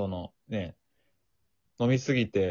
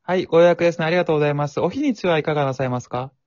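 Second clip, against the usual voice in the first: high, bright polite clerk; chirpy front-desk phone manner; customer service voice; 4 semitones higher than usual. Pretend. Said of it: "店員さんのテンション".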